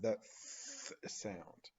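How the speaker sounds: a slow, soft unvoiced th; th sound. Shown as a demonstration. Teacher showing the th sound to children. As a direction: blow the th softly. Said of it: "the T H sound".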